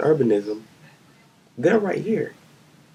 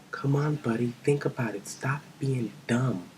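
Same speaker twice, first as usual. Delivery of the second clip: scornful whisper; 6 dB quieter than usual. Acted. Scolding the governor in a rant.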